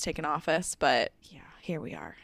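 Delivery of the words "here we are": whispered under the breath; resigned whisper. Real, not acted. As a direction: drop to a dry whisper to close the point. Wry close to a grim point.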